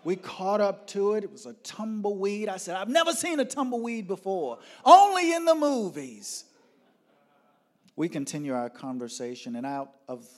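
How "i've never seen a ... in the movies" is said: high, loud, gleeful exclaiming; thrilled outburst climbing to a yell; excited amazement. Retold or imitated. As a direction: build to a shout of wonder. Retold excitement at first tumbleweed.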